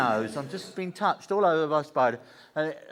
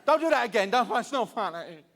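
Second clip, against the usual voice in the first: high, loud panicked begging; a scared partner yelling at you to stop; panicked pleading; 6 semitones higher, 4 dB louder than usual. Retold or imitated. High, loud panicked yelling at the joker.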